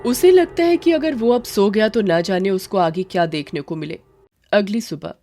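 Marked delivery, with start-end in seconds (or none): none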